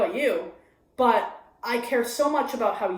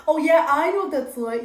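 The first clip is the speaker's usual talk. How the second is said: higher, louder self-centered friend butting in; mock the friend who makes it about herself; imitating annoying friend; 6 semitones higher, 7 dB louder than usual. Friend hijacking the story, high and loud mockery. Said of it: "as opposed to".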